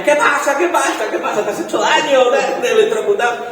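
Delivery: shrill worried cries, imitating parents; alarmed parents.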